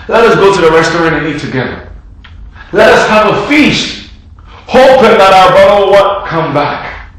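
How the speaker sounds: loud, high preaching voice. Acted. Preacher urging the church to console the Father, impassioned. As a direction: preach it out to the congregation.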